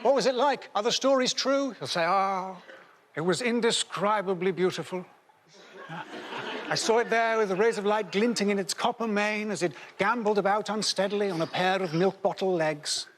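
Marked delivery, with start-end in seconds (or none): 0.0-1.7 put-on high, breathless children begging for the story; mock kids begging for the tale; eager children. Voicing eager great-grandchildren. 2.0-13.1 dreamy, wistful fairy-tale telling; hammed-up grandparent recounting a mythical beast; storybook narration.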